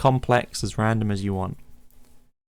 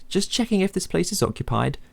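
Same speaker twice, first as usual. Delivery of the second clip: a higher, light voice for the visitor; stranger's voice; 8 semitones higher than usual. Acted. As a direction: play the friendly stranger. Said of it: "The man smiles".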